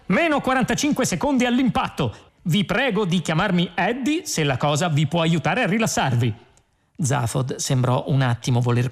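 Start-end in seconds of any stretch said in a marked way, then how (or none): 0.0-6.3 high, perky computer countdown; brisk cheer. A narrator's reading. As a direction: announce doom as if helpfully upbeat. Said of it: "spiegò con brio il computer". (computer brioso e premuroso, voce acuta)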